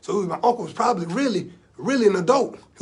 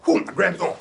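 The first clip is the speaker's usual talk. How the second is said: ordinary talk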